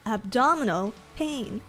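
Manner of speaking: ordinary talk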